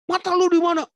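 high-pitched comic shout; Batak yell. Acted. Batak man to whoever wronged him, yelling. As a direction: imitate an instant angry yell, pitch way up. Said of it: "orang Batak langsung teriak".

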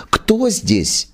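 high startled cry of fright; frightened cry. Acted. Actress discovers a man under her sofa, cries out in fright. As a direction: cry out in terror at the shape. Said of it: "вскрикнула она в ужасе".

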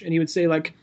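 ordinary delivery

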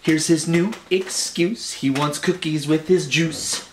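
usual speaking voice

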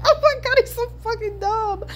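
high, squeaky laugh-talk; laughing in disbelief. Real, not acted. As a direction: crack up helplessly as you speak. Cracks up at dumb character.

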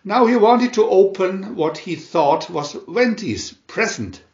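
ordinary delivery

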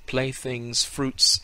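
ordinary delivery

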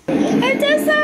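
high, loud call across the house. Real, not acted. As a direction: call her name up the stairs. Calling daughter from her nap.

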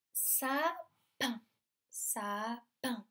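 "sapin" split as sa, pause, pin; syllable by syllable. Shown as a demonstration. Teacher letting children hunt for P, split syllables. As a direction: stretch sa, then pop the P of pin. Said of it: "Est-ce qu'on entend le P?".

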